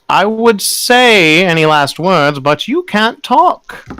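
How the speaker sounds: slow, high sneering taunt; mocking preacher. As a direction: savour the cruel joke slowly. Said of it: "he goes".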